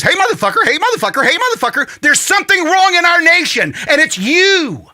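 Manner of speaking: ranting at couch sitters, high and loud; squeaky wheel yelling. Acted. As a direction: yell it at the nation like the squeaky wheel. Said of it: "the squeaky wheel that says".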